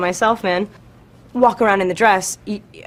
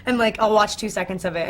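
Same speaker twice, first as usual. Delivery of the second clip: ordinary delivery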